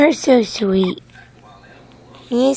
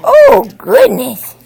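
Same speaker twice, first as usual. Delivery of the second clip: excited; 11 semitones higher, 9 dB louder than usual. Real, not acted. Reacting to the baby.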